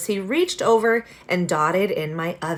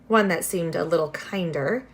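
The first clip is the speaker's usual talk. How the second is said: usual speaking voice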